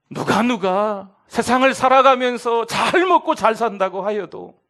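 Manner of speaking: fervent pulpit shout; preaching shout. Acted. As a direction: call out over the congregation.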